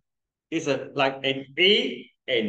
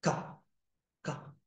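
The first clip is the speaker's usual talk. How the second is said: 卡 said softly, almost under the breath; quiet demo; 2 semitones higher, 12 dB quieter than usual. Shown as a demonstration. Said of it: "它什么念".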